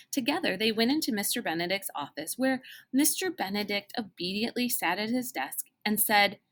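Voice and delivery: plain reading voice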